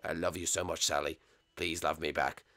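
low, soft, pleading boss voice; lovesick boss. Acted. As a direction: coo like a smitten boss. Boss confesses love to Sally.

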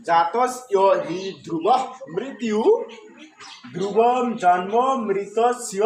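slow, measured Sanskrit with "জাতস্য" as ja-tas-iyo; demonstrated pronunciation. Shown as a demonstration. Teacher sums up the verse's pronunciation, slow careful "জাতস্য" and "মৃতস্য". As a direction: recite slowly word by word, "মৃতস্য" as mri-tas-iyo. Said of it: "তাহলে উচ্চারণ কি আসলে".

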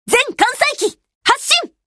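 high-pitched rallying order; commanding call in battle. Acted. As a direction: launch every plane, urgently. Orders all planes to take off.

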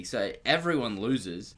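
ordinary talk, nothing marked

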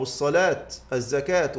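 الصلاة and الزكاة with final t sounded; rare pausing on t. Shown as a demonstration. Teacher showing a rare usage, الصلاة and الزكاة ending in t. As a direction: stop both الصلاة and الزكاة on "-aat". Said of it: "وهذا قليل".

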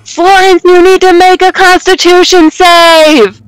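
yelling down. Acted. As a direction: call down to someone far below. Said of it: "I yell down to Florence".

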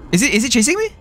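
usual speaking voice

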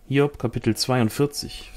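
ordinary delivery

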